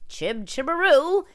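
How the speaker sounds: hammed-up Mary Poppins Cockney; mock Cockney accent. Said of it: "it's pretty bad".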